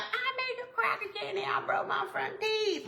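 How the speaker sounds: high mock complaint in a put-on voice; mock complaining viewer. Imitating a viewer who froze the candy, high mock complaint. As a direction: play the careless viewer griping, voice pitched high. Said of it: "don't be coming to me like".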